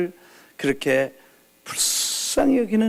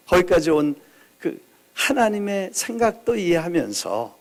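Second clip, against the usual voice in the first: ordinary talk, nothing marked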